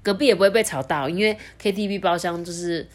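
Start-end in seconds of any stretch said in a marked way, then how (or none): none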